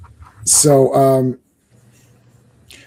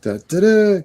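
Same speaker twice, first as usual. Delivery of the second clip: ordinary delivery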